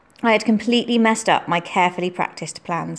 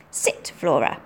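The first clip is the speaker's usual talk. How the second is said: firm command; 5 semitones lower, 3 dB quieter, slower than usual. A narrator's reading. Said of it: "commanded Lady Hurstwood".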